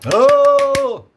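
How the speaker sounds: loud, high, held cry of "Ô!"; loud drawn-out "Ô!". Real, not acted. Teacher catching own mistake mid-lesson, loud long "Ô!". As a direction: shout a long high "Ô!" at your own blunder.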